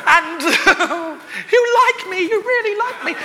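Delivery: high, laughing mock acceptance speech; mock award speech. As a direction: ham up a tearful Oscar thank-you while laughing. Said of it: "what a prat, look at this".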